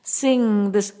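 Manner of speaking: ordinary delivery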